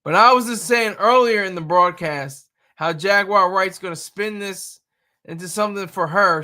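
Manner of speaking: ordinary talk, nothing marked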